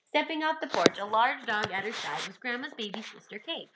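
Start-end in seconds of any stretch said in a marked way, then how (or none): none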